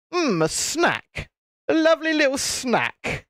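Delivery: high, mock-savouring voice; hungry gator. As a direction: relish it like a peckish cartoon gator. Gator savours the sight of prey.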